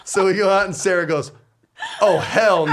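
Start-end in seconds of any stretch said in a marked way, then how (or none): 2.0-2.7 loud, high mock outrage; appalled refusal. Man acting out his wife spotting the shirt, appalled. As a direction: imitate an appalled wife, loudly.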